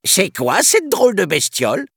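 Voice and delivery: high mocking monkey voice; sneering monkey. Second monkey mocking Mowgli, high sneering voice. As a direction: sneer at the odd creature like a cheeky ape. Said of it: "ricana un autre".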